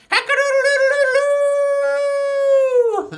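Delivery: high, loud, long-held crow; rooster crow. Pretend. Host plays farm song's rooster, crowing loudly. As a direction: crow like a rooster, holding the last note. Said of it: "he makes a sound like this".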